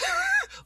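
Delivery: squeaky high giggle; very high laugh. Real, not acted. Laughing at his own silver medal reaction, squeaky high laugh. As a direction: let the laugh escape high. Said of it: "my first instinct was like".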